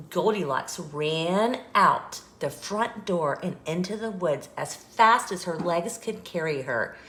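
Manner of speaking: usual speaking voice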